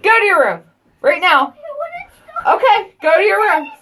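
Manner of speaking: loud, high, stern order to go to their rooms. Real, not acted. Mother yelling at fighting kids downstairs, loud and high. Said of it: "I don't normally yell".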